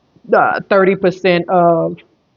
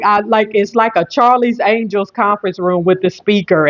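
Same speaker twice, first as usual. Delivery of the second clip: ordinary delivery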